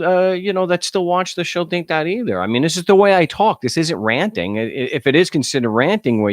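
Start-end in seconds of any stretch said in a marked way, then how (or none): none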